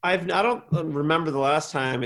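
ordinary talk, nothing marked

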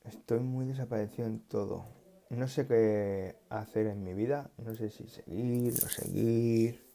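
usual speaking voice

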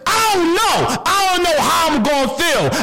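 high, shouted panic of someone hiding; anguished hiding person. Acted. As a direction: cry out as someone terrified of being found out. Imagined person fearing exposure.

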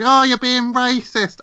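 high, slow, whiny mock outrage; hammed-up pearl-clutching voice; mock offended complainer. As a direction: parody someone taking offence, drawn out and shrill-pitched. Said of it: "As soon as you do an Indian accent".

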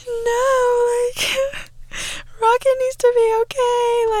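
very high, whimpering plea with a crying laugh; hammed-up teary wail; mock sob plea. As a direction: beg for Rocket to be okay, half-crying. Retold emotional plea over movie.

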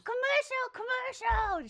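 high, loud screamed complaint; imitated child's scream. Parent imitating a child who wants ads to stop, screaming. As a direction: scream it out like an upset kid, imitating. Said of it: "She just starts screaming".

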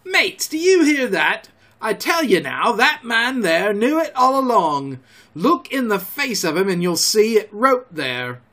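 high, loud accusing rant; outraged pirate shouting; furious screaming. A narrator's reading. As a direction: rouse the crew with angry cries. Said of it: "repeated Mary in a scream".

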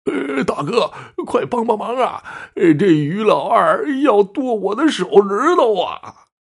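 high, wailing cry for help from a cowardly little brother; panicked plea. A narrator's reading. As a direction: beg big brother desperately, dragging out the last words. Fleeing man begs brother for help.

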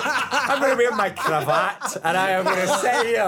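pompous writer, laughing; posh author act. Pretend. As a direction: play the grand author in a cravat, laughing. Mocking posh author pose.

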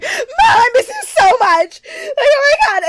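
hammed-up high, loud wailing; screechy sobbing put on for effect; mock crying scream. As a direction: imitate a hysterical tearful phone call. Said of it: "Crying and screaming".

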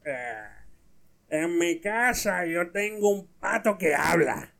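put-on slow, slurred drunkard voice; comic drunk slur; drunk man. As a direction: drag it out like a boozy bar boast. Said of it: "un borracho le dice al otro".

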